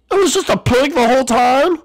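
very high, incredulous squeal; shocked disbelief. Pretend. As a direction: exclaim it loudly in disbelief. Discovers the intruder is a pig.

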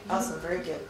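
usual speaking voice

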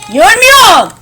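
loud and high, startled outburst; sudden shout. Real, not acted. Gamer yelling at the screen that he can't see.

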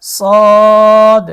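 সদ held loud on a long rounded o; three-alif stretch. Shown as a demonstration. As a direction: stretch the vowel of সদ for three alifs, loud and even. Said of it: "তিন আলিফ টান". (সদ জোরে তিন আলিফ টেনে)

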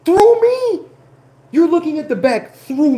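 high, loud disbelief; outraged shout. Retold or imitated. He yells back at the guard in outraged disbelief. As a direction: throw it back at her, outraged.

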